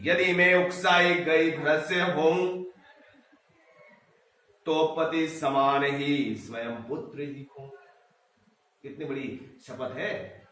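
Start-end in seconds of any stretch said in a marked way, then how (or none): 0.0-7.7 slow, drawn-out verse reading; poem recitation. Acted. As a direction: recite verse with long, measured syllables. (धीमा, लयबद्ध कविता-पाठ)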